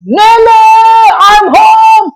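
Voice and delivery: put-on high excited shout of a small boy; child shouting. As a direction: call out like a four-year-old bursting in. Child calling out on arrival. (teriakan anak kecil yang girang)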